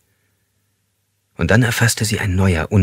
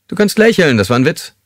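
mock cheerful; 9 semitones higher, 5 dB louder than usual. A narrator's reading. Captor joking cruelly.

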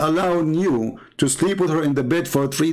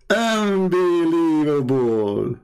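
drawn-out disbelief; much slower than usual. Real, not acted. Repeated, slower.

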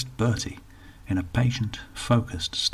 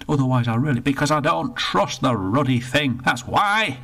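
put-on indignant old northerner, rushed in the middle; grumpy old Bertie; 6 semitones higher, 4 dB louder than usual. Bertie complains to the attendant, indignant grumble. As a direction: grumble about the lost pound.

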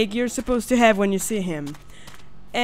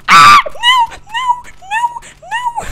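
high panicked scream fading into whimpered no's; real fright, shrieking then whimpering; scared; 6 dB louder than usual. Real, not acted. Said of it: "I get so loud when I'm scared".

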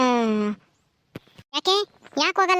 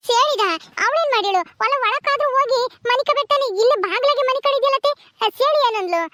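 put-on a higher, quicker young woman's voice, surprised; young daughter-in-law; 5 semitones higher than usual. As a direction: play the startled young wife finding her mother-in-law. Same young wife figure. (ಅತ್ತೆಯನ್ನು ಬಾಗಿಲಲ್ಲಿ ಕಂಡು ಅಚ್ಚರಿಪಡುವ ಸೊಸೆ, ಎತ್ತರದ ಧ್ವನಿ)

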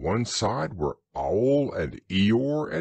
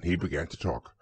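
usual speaking voice

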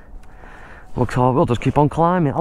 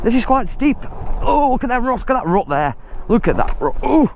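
higher, jumpy alarm at the steep climb; mild panic; 7 semitones higher than usual. Real, not acted. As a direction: blurt it nervously, pitch jumping up. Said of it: "let's just stop panicking".

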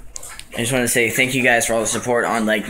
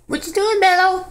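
dog talk. Real, not acted. Cooing very high to her dog Mello. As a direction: coo high at the pet.

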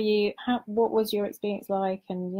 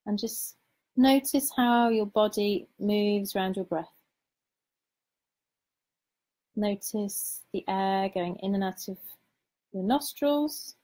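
guided meditation. Acted. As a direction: lead them gently into calm, unhurried.

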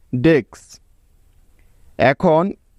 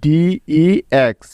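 spelled out slowly; much slower than usual. Shown as a demonstration. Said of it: "তাহলে".

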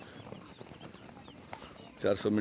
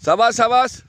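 shouted urging; 11 semitones higher, 7 dB louder, slower than usual. Real, not acted. Coach urging runner on track.

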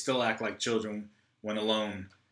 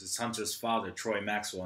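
usual speaking voice

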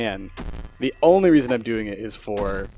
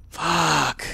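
higher-pitched, drawn-out swear; frustrated curse; 4 semitones higher than usual. Real, not acted. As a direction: let out a pained curse. Swearing over the loss, first take.

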